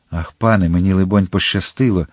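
ordinary delivery

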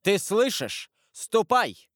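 high, loud order; father ordering his son to leave; stern command. A narrator's reading. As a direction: bark the boy out of the room. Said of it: "крикнул Вильфор так резко".

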